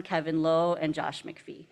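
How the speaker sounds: ordinary talk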